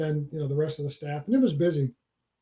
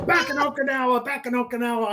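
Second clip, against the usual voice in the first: lightly voiced grizzled veteran, higher; a hint of a proud old soldier reminiscing; gruff old marine; 5 semitones higher than usual. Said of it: "You know".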